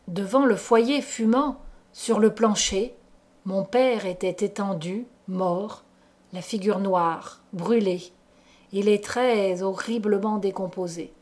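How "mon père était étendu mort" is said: ordinary delivery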